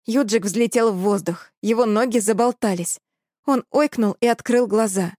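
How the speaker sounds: plain reading voice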